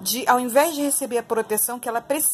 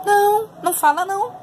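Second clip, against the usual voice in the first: sarcastic exclamation; 10 semitones higher, 5 dB louder than usual. Real, not acted. Sarcastic answer to own question.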